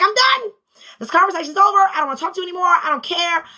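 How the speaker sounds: high, loud retold outburst; blowing her top. Mother acting out blowing up at her son, angry outburst. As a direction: snap it out, acting out lost patience. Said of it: "I blew my top".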